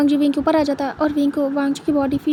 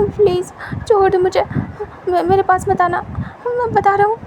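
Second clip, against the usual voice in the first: put-on frightened pleading young man; 5 semitones higher than usual. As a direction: plead in a rush, cry out for mama. Wing begging his captor.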